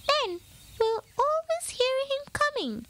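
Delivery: high eager mouse voice; explaining little mouse. A narrator's reading. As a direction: lay out the plan, squeaky. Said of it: "Pip explained".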